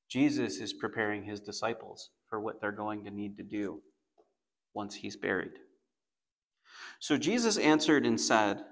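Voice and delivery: ordinary talk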